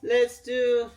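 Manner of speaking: high, loud, panting after the plank; proud and winded, pushing the words out; breathless triumph. Real, not acted. Vlogger to viewers after the plank, winded and proud.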